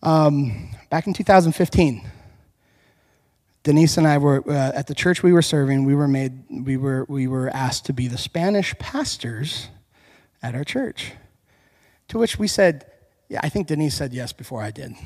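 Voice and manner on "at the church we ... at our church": ordinary talk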